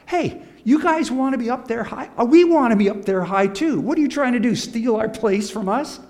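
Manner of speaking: high, loud, whiny protest; mock the jealous disciples; indignant disciples. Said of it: "the other ten guys are over here saying".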